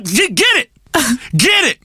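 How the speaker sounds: normal talking voice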